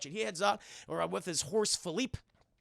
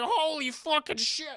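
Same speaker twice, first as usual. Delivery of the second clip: high, slow mock-horrified swearing; alarmed; 10 semitones higher, slower than usual. Horse come upon by wolves, alarmed. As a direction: stretch out the panicked curse. Horse suddenly faces a wolf pack.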